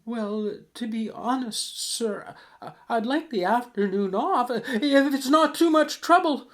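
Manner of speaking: high, pleading meek clerk; squeaky, hesitant request for a favour; timid Cratchit. Acted. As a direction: ask the boss nervously, pitch climbing. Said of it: "Cratchit said".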